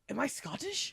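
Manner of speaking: hammed-up Scottish delivery; bad Scottish accent. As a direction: imitate a Scot badly. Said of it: "I have a horrible Scottish accent".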